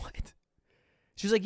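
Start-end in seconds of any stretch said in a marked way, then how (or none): 0.0-0.3 breathless, whispered disbelief; stunned whisper. Retold or imitated. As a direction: whisper it like you can't believe it. Stunned reaction to [name] news.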